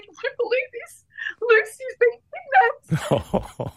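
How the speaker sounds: high, very slow mock-tearful lament; retold crying. Author telling husband her character's kidnapped, mock weeping. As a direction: drag it out like a sob. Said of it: "I'm bawling my eyes out".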